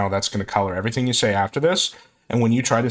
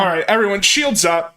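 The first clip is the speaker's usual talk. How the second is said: high, loud captain's order; mock commander; 9 semitones higher, 4 dB louder than usual. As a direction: call it like a starship captain.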